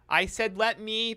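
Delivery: over-enunciate as if to the hard of hearing. Acted. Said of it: "She raises her voice and starts speaking slowly".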